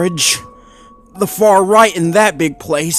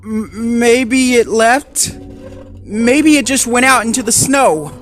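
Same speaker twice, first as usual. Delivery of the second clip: shaky hope; 12 semitones higher than usual. Acted. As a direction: talk himself into calm. Shaking, hoping aloud.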